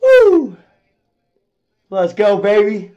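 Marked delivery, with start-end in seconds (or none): 0.0-0.6 high, loud "woo"; real celebratory holler; excited whoop. Real, not acted. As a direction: whoop with relief and glee. Celebrating a good hand.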